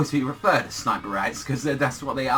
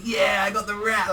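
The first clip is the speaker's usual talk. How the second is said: higher-pitched retold cheer; mock triumph; 6 semitones higher than usual. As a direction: imitate a victorious cry. Acts out character's triumph.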